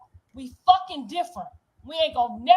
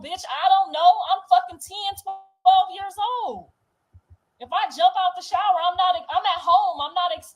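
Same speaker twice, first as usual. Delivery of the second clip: higher, louder angry protest; heated protest; 5 semitones higher, 5 dB louder than usual. Real, not acted. Defending her ten-year-old self to her mother, higher and louder. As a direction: push back hard in disbelief.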